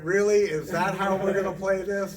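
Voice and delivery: loud, high incredulous "Really?"; mock disbelief. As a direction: act stunned at the coincidence. Mock outrage at golden retriever.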